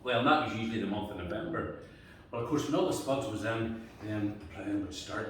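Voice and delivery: usual speaking voice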